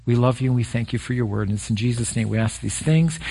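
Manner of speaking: hushed pastoral prayer. Acted. Leads a closing prayer.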